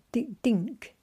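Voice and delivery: ordinary delivery, nothing marked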